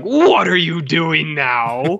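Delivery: slow, drawn-out mock-dramatic demand; Charles Dance. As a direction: ham up a grand, lingering question. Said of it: "where Charles Dance is like".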